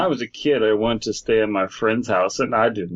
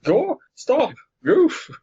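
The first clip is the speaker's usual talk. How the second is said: high squeaky cartoon mouse, angry; angry falsetto cartoon mouse; Mickey Mouse voice; much slower than usual. Voicing Mickey.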